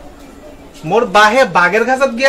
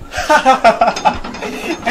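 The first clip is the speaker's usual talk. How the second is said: mocking laugh. Husband laughs at wife's boast.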